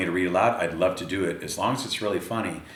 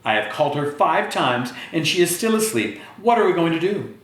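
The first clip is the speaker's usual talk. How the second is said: mother; 8 semitones higher, 3 dB louder than usual. Acted. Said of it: "said her mother".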